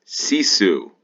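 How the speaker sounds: reading the sign out. Real, not acted.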